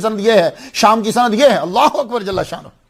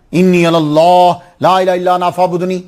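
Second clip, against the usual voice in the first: measured, sustained recital of sacred text; Arabic recitation; 3 dB louder than usual. Shown as a demonstration. Preacher demonstrates a hadith text to listeners, formal recitation. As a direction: recite it as scripture, drawing out the long vowels. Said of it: "وہ جو آگے میں پڑھتا ہوں".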